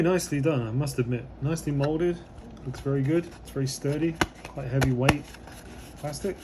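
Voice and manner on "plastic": normal talking voice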